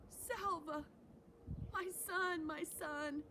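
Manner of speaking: high joyful cry to his son; cried out. Acted. Father finds his son again, crying out in high joy. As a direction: call the name out, overcome with joy. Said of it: "Then he cried out".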